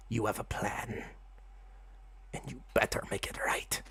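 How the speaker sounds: hushed, secretive whisper; whispering rat god. Acted. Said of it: "Rethaniel goes".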